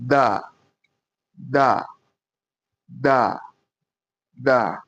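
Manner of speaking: "Da" light and short. Shown as a demonstration. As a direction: keep "da" light, at the front of the mouth. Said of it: "this one is going to be light".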